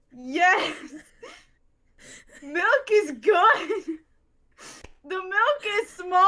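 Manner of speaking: high, loud, deadpan mock translation. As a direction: dub their broken Spanish like a silly interpreter. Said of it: "Do you wanna know what conversation you both just had?".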